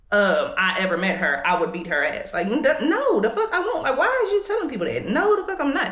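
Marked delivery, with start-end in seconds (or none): 2.3-5.9 high, laughing outrage, pitch jumping up; heated disbelief. Real, not acted. To viewers about her mom's claim, laughing indignant denial. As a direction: scoff it off, half laughing, half furious.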